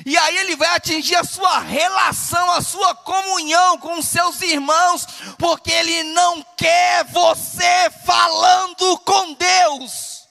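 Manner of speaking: mounting preacher's list, rising to loud, high shouts; preaching, building to a shout. Real, not acted. Preacher warning what the devil will attack, building to a shout. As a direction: build each phrase until you are shouting.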